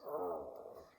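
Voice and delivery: very low growl; wolf growl. Acted. Mother wolf growling at the girl, deep growl. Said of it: "the mother wolf growls".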